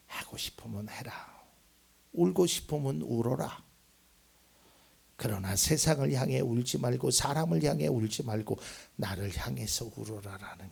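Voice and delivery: soft, hushed, tender voice; quietly allow the tears; God's gentle permission. Acted. Preacher voicing God inviting the congregation to cry, soft. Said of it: "근데 하나님은".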